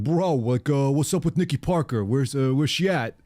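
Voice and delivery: a light put-on bro voice; lazy buddy asking about a crush; bro talk. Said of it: "Because that's how he talks".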